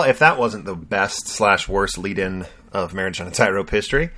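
ordinary talk, nothing marked

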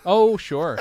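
usual speaking voice

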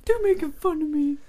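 high, loud imitation of a kid's complaint; mock whining. Thin-skinned boy complaining he is teased, mock whine. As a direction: whine it like a wounded child.

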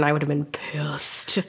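hushed, breathy whisper of disappointment; sad whisper. Real, not acted. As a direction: sigh the words out softly. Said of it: "She's, like, sad".

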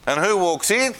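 ordinary delivery

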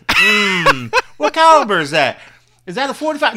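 high, loud mocking voice; mock gun-store chat. Mocking a gun buyer asking the caliber, high loud parody. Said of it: "They said".

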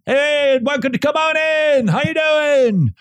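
mock Buc-ee's greeter shouting a welcome; cheery yell. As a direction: holler a big Southern greeting across the store. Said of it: "And they yell at you".

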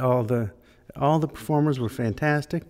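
ordinary talk

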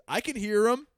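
high, loud imitation of a clueless guy; full-volume fan. Loud guy talking over a comic's phone recording, imitated at full volume. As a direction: blurt it out loudly, mimicking an oblivious fan. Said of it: "comes over in full volume".

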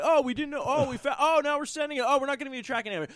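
lightly voiced, careless mic stand company; mocking company. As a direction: parody the shipper's lame excuses. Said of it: "They're like".

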